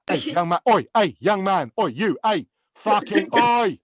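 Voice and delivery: loud, mock-angry barked calls; Gordon Ramsay. Chef hailing a young cook to praise him, angry barking. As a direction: bark repeated attention-grabbers, stretching the last. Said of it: "can sound angry and pissed off".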